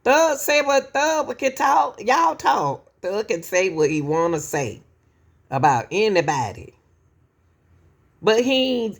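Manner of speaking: ordinary delivery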